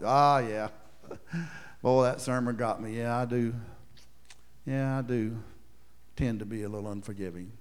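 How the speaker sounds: low, soft, sheepish confession; sheepish hearer. Pretend. Preacher voicing a convicted listener, sheepish and soft. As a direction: own up like a caught churchgoer.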